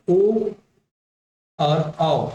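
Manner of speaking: ओ and औ drawn out very slowly; slow vowel demo. Shown as a demonstration. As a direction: stretch each vowel for the class. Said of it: "इन तीनों को बोल के देखिए". (धीरे धीरे खींचकर ओ और औ)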